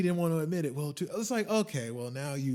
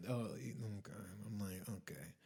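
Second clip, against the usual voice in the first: soft, breathy aside; hushed sarcastic okay; whispered okay; 6 semitones lower, 15 dB quieter than usual. Retold or imitated. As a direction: mutter it under your breath. Muttered reaction to rep.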